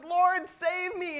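high, drawn-out cry for help; hammed-up plea of a sinking man. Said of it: "he cries out".

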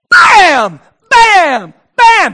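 high, explosive shouted bams; shouted banging noises. Retold or imitated. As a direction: pound out each bam at full volume. Acts out pounding on the door.

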